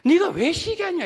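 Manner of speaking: loud, high-pitched mock scolding; acted rebuke. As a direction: demand it loudly, as God confronting Cain. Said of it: "이 말이에요". (하나님이 꾸짖듯 높고 크게 따지는 말투)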